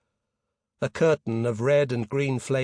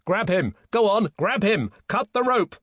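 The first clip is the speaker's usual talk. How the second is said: loud orders climbing higher; urgent shout; 8 semitones higher, 5 dB louder than usual. A narrator's reading. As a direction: shout desperately to the deckhands, rising to a peak. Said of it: "Peter shouted".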